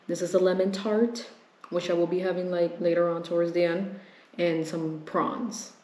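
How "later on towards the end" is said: ordinary talk, nothing marked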